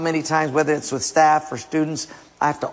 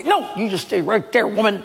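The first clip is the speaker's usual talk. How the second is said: husband in a huff; 6 semitones higher than usual. Acted. Acted husband storming off.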